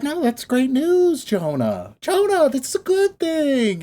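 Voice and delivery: slow, high-pitched cajoling reassurance; God. Retold or imitated. God telling a pissed prophet it's good news, coaxing. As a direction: coax gently, stretching the words. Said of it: "And God's like".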